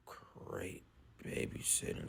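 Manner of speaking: whispered aside, barely voiced; breathy sarcastic whisper; under his breath. A narrator's reading. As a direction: mutter it so Mom can't hear. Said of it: "said Dash under his breath".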